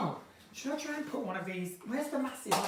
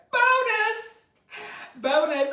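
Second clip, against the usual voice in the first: excited cheer; 11 semitones higher, 6 dB louder, much slower than usual. Real, not acted. Excited find of vodka.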